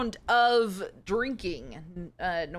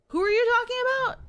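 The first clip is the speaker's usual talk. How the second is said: much higher, louder and fast, alarmed question; alarmed character; 5 dB louder, faster than usual. Acted. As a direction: play a spooked traveller. Voicing alarmed game character.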